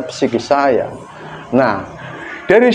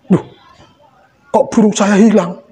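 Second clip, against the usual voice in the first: mock shock; 7 semitones higher than usual. Comedian telling the mirror story in mock shock. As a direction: act startled at the loss.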